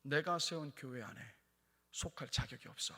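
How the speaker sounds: quiet acted divine rebuke; the Lord's verdict. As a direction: deliver the judgement softly, fading to near whisper. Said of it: "라고 주님은 말씀하신다라는 거예요".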